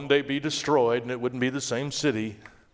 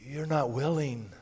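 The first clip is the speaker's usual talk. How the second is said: much softer, sorrowful reproach; quiet grieving rebuke; 6 dB quieter than usual. Acted. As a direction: let the words sink in softly. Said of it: "But he said".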